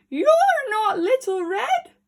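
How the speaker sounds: high, loud scolding cry; indignant granny yell; outraged shout. Acted. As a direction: shout him off.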